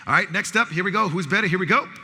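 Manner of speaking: loud, high call-and-response hype; game announcer. Real, not acted. Host calling out music match-ups to the audience, loud announcer hype. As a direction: fire up the crowd to shout back.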